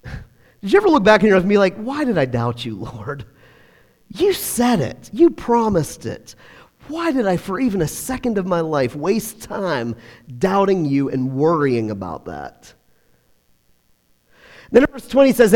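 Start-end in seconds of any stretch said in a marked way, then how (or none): 1.8-12.7 softer, hushed, regretful to God; remorseful prayer. Pretend. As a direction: murmur it like a private confession.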